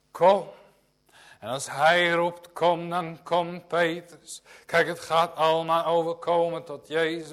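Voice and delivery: loud, fervent pulpit preaching; preaching at full force. Acted. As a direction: drive it home like a revival preacher. Preaching to the congregation. (vurig en luid preken)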